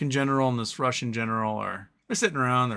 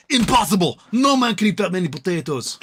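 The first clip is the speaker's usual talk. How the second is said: loud indignant outburst, higher; mad Russian general; 7 semitones higher, 7 dB louder than usual. Acted. Russian general in the joke shouting in outrage. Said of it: "gets mad and goes".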